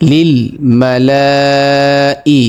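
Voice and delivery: মালাই with the first vowel held for four alif counts, chanted; long vowel stretch. Shown as a demonstration. Teacher demonstrating the four alif lengthening in মালাই. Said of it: "চার আলিফ পরিমাণ টান হবে".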